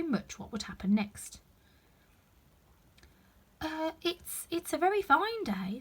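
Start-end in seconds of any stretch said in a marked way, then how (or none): 3.6-5.8 high, hesitant rabbit voice; timid voice. Acted. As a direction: stammer nervously. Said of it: "said a timid voice".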